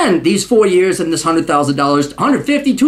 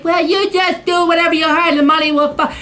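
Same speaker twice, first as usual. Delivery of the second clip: higher, syrupy mock mother voice; 6 semitones higher than usual. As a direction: parody a doting mother. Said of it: "your presumed loving mother is like".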